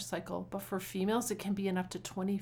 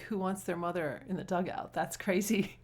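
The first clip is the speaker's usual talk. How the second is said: usual speaking voice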